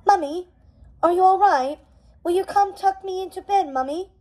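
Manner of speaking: put-on high, pleading little-boy whine; lightly voiced whiny prince; plaintive child voice. A narrator's reading. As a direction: ask like a lost child wanting his mummy. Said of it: "Prince John asked plaintively".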